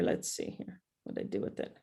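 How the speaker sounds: whispered under the breath while searching; barely voiced, talking to herself as she looks; muttering to herself. Real, not acted. Searching her phone mid-talk.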